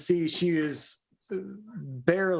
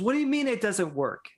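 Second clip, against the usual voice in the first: higher, indignant disbelief; outraged lord; 7 semitones higher than usual. Acted. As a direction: protest like a swindled nobleman. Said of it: "He goes".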